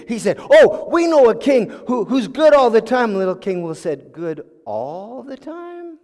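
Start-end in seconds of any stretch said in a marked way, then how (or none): 0.4-3.1 louder, higher, eager pitch to the king; eager announcement. Acted. Advisor eagerly proposes another king. 4.1-6.0 soft, very slow, rising disbelief; incredulous question. Acted. King doubts the advisors' claim.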